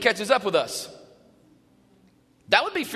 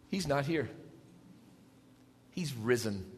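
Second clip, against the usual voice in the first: solemn; 7 semitones lower, 6 dB quieter than usual. Acted. Reading the angel's words.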